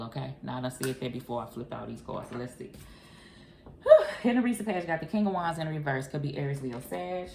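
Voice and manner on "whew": high, loud whistling whew. Real, not acted. Reacting to the cards with a loud high whew. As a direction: blow out a big whew.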